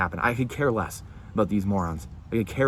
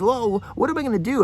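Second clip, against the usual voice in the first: mock grovelling; 12 semitones higher than usual. Mocking grovelling people.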